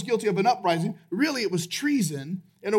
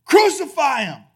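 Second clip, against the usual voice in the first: loud, higher crowd cry; louder shout back; 5 semitones higher, 8 dB louder than usual. Retold or imitated. Crowd shouting back at Pilate instead of answering, louder shout. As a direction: shout the demand back harder. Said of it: "They just shout back even louder".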